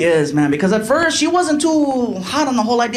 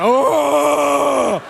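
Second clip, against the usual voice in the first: long, higher-pitched "oh"; mock wounded "oh"; 5 semitones higher than usual. Comic feeling judged by the audience, long mock wounded cry. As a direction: draw out an oh as if stung. Reacts to audience judging him.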